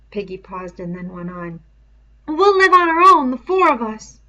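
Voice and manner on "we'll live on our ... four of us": put-on high, loud boyish voice, urgent; a frightened boy pressing his friend, pitched up high; Piggy's high pleading voice. A narrator's reading. Piggy plans their future.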